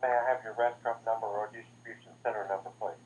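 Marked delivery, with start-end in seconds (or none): none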